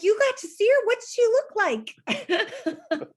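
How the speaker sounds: put-on high, loud eager kid questions with a laugh; excited kids. Teacher quoting her excited kids, high and eager. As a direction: gush like a thrilled child. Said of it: "they're like".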